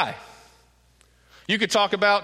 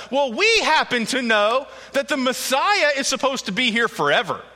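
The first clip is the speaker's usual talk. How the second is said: higher and louder, mocking challenge; defiant crowd; 5 semitones higher, 4 dB louder than usual. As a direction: throw it back at Jesus with scorn. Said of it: "very defiant".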